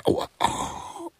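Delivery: sudden high pained au au, breathy; pained yelp. Acted. Man with a full bladder yelps in pain. Man desperately needing to urinate.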